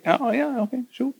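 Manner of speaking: high, airy mock boss voice, half-hearted; mock hiring manager. Parodying a manager hiring an overqualified nurse, high and mocking. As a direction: lightly parody a boss who can't say no.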